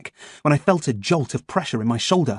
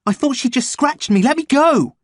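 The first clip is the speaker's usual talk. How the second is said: panicked shout; 14 semitones higher, 6 dB louder than usual. Acted. Strapped boy struggling, begging release.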